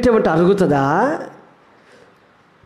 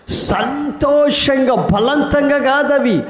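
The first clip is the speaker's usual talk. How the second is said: higher and slow, ending in a shout; joyful praise; 4 semitones higher, slower than usual. Shown as a demonstration. Preacher showing how to praise God joyfully, rising to a shout. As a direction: build up to jubilant praise. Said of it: "ఆత్మీయంగా చెప్పాలంటే సంతోషంగా".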